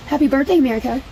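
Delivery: high, bright holiday cheer; singsong cheer. Real, not acted. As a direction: lift the voice way up to celebrate. Cheery holiday greeting to viewers.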